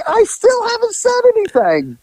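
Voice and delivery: high, whiny meek protest; a timid partner insisting he's innocent. Retold or imitated. Silent partner protesting he said nothing, high and whiny. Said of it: "And Mac again's like".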